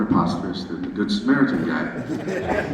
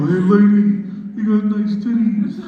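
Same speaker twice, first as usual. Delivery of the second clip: catcalling guy; 6 semitones higher than usual. Retold or imitated. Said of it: "when I see a guy go".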